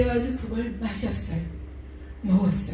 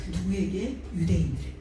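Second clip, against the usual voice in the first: usual speaking voice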